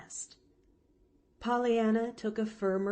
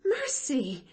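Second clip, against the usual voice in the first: higher-pitched mild gasp; 8 semitones higher than usual. A narrator's reading. Nancy is aghast at the offer, gasping lightly. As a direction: blurt it out aghast. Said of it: "gasped Nancy".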